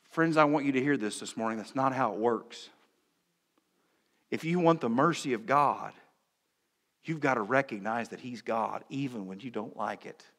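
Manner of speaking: ordinary delivery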